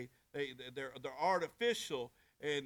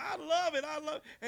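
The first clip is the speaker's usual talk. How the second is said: higher, loud burst of delight; fired up; 13 semitones higher, 5 dB louder than usual. Retold or imitated. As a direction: let the joy spill out. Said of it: "He's like".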